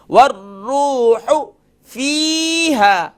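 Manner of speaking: slow melodic tajweed chant; Quran recitation. Shown as a demonstration. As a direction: recite slowly with the ha kept short. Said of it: "short sound کرنا ہے". (قرآن کی تلاوت)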